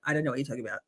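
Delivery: low, soft, flat statement; blunt deadpan. Retold or imitated. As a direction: drop it bluntly, no fuss. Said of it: "I just straight up will go".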